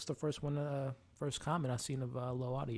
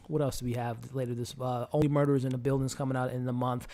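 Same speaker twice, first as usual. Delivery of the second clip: usual speaking voice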